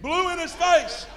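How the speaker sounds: loud. Retold or imitated. Acting out blowing in face.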